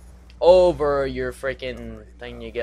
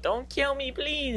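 mock pleading squeak; 12 semitones higher, 5 dB quieter, slower than usual. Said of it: "I'm just like".

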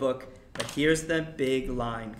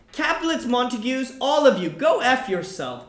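high, loud outburst at both families; furious curse; 9 semitones higher, 7 dB louder than usual. Retold or imitated. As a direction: hurl it at both houses, fed up. Mercutio cursing both houses.

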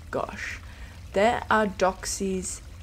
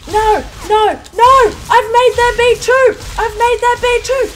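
very high, loud shrieking; panicked shriek; 10 semitones higher, 12 dB louder than usual. Real, not acted. Player shrieks during her meltdown, panicked. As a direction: scream out in full meltdown.

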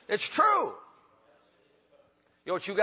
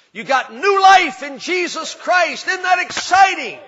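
loud, high, slow preacher's cry; full-force gospel shout of new life; preaching shout; 8 semitones higher, 10 dB louder, slower than usual. Real, not acted. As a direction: shout it to the back pews.

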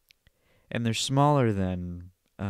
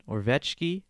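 ordinary talk

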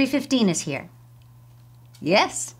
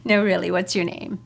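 ordinary delivery

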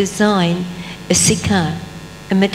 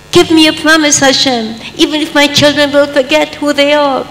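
high, loud pleading to God; impassioned plea; 7 semitones higher, 5 dB louder than usual. Acted. Abraham begging Hashem for a promise, loud and high. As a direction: beg God for the promise with full force.

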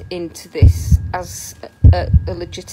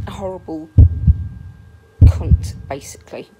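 dropped to a near whisper, spitting the insult; muttered insult; 4 semitones lower than usual. Real, not acted. Ranting vlogger mutters a scornful insult about the reviewer, near whisper. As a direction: hiss the insult under your breath.

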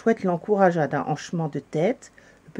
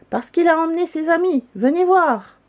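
urgent; 10 semitones higher, 5 dB louder than usual. A narrator's reading. Big Santa reports urgently to chief.

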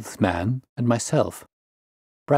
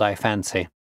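usual speaking voice

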